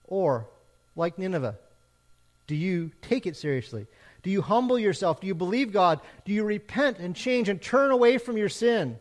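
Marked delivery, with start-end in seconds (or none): none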